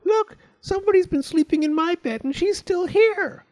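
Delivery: loud, very high squeak; Baby Bear crying out. A narrator's reading. Baby Bear spots the sleeping girl, squealing. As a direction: squeal the discovery out to the family. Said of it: "Baby Bear cried out".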